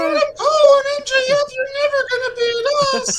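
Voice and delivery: shrieking mock falsetto, very loud; hammed-up girlish taunt, way up high; high-pitched voice. Parodying the tall wrestler taunting his rivals, high-pitched impression. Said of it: "He's got a high-pitched voice".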